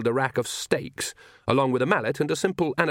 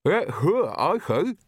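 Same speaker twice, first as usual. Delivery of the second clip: higher, muffled talk round a mouthful; mouth full; 7 semitones higher, much slower than usual. Acted. Nanny speaks with lemons in her mouth, muffled. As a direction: try to talk with a bag in your mouth. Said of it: "bag of musty lemons in her mouth".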